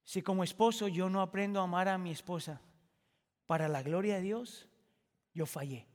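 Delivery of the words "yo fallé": low, softer admission; a hushed owning of failure; quiet confession. Acted. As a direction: let it drop, softer and lower.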